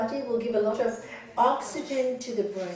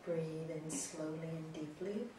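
soft spa whisper; 3 semitones lower, 14 dB quieter than usual. Acted. Leads breathing softly.

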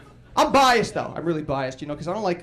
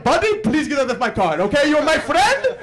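mock Middle Eastern accent, higher and louder, polite but fed up; lightly imitating a Middle Eastern Uber driver kicking a drunk out; 7 semitones higher, 4 dB louder than usual. Uber driver throwing drunk passenger out, mock Middle Eastern accent. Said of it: "he was Middle Eastern".

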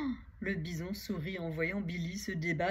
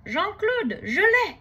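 high, loud victory shout to friend; triumphant cry; 13 semitones higher, 10 dB louder, slower than usual. Acted. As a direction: call out the catch proudly. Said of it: "s'exclame Billy". (cri de triomphe aigu)

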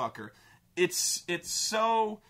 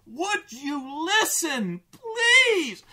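slow then louder, high shout on please; exasperated yell; 11 semitones higher, 6 dB louder, much slower than usual. Real, not acted. Viewer fed up with the dad, yelling would you listen please. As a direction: demand he finally listen.

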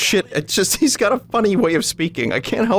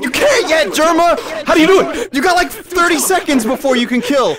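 high, loud exasperated instruction; urgent shouting; 12 semitones higher, 4 dB louder than usual. Real, not acted. Player telling Jerma he can't kill yet, urgent shouting. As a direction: holler the rules at an impatient teammate.

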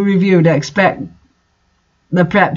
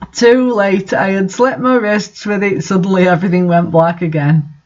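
usual speaking voice

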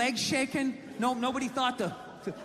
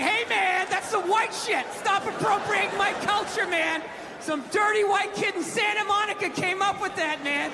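mock offended skater; 6 semitones higher than usual. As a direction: ham up a petty offended complaint. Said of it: "Do I get all offended like".

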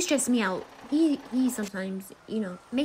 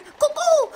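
high loud cuckoo call; cuckoo bird call; 7 dB louder than usual. Shown as a demonstration. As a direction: mimic a cuckoo, shrill and loud. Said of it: "You think I can sound like a bird?".

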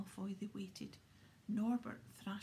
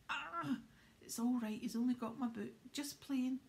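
louder, higher jolly Hagrid; Hagrid reassuring; 4 semitones higher, 6 dB louder than usual. Acted. Hagrid at the window shrugging off the bite, booming. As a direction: laugh off the dragon bite heartily.

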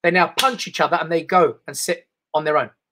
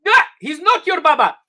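much higher, loud woman's retort; 10 semitones higher, 3 dB louder than usual. Retold or imitated. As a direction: toss it off like a sharp auntie. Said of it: "this woman goes".

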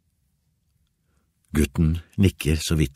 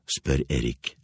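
usual speaking voice

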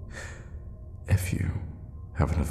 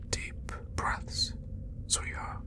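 very soft, breath-led coaxing; hushed endearment; soothing whisper; 12 dB quieter, slower than usual. Acted. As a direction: whisper it to calm your victim. Soothing victim during bloodletting.

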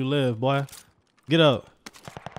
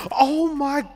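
amazed exclamation; 11 semitones higher than usual. Real, not acted. Reacting to the game.

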